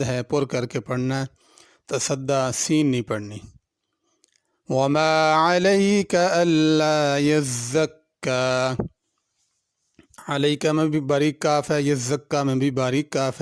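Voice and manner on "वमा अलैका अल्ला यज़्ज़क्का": slow, drawn-out melodic tajweed chant; Quran recitation. Acted. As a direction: recite slowly with long stretched vowels.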